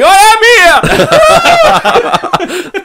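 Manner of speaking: very high, drawn-out mock yell; hammed-up shout from the masthead; triumphant holler. Imitating Paddy hanging off the mast, high triumphant shout.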